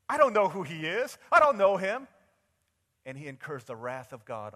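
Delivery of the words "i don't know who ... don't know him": loud, high shouted disowning; vehement denial. Acted. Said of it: "the most violent thing he can do".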